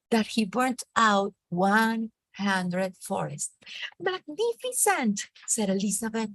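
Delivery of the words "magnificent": excited praise. Acted. Elizabeth praises the dragon again.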